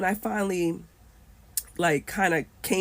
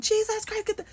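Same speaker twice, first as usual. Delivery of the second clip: high Trinidadian scream; screaming Trini accent. Retold or imitated. Voice screams as baby hangs from window, Trini accent. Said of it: "And I hear screaming".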